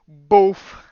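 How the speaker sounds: loud, punched blast noise; sudden booming puff; boof sound effect. Retold or imitated. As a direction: burst out a mock explosion. Mimics reality changing blast.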